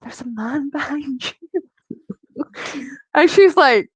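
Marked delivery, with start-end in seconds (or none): 0.0-2.4 slow, hushed whisper with a nervous laugh; whispered warning. Retold or imitated. To her client during eyebrows, a slow nervous whisper. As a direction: breathe it out slowly, scared to say it. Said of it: "I was like to my client".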